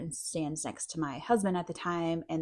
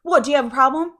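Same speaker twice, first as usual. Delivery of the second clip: louder, higher mock yell; mock scream; 7 semitones higher, 10 dB louder, faster than usual. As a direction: pick a fight, loudly. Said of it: "so she's screaming".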